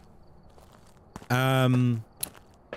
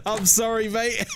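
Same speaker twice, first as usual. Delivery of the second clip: mock apology; 7 semitones higher than usual. Repeats the line in a mock voice.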